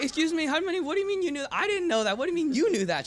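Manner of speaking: high, loud incredulous outburst; shocked disbelief. Retold or imitated. Grandson to grandmother who already knew, shocked and high-pitched.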